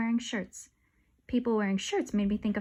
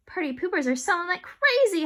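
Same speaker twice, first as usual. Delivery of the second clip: hollering; 7 semitones higher, 4 dB louder than usual. Acted. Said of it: "hollered Missus Masters".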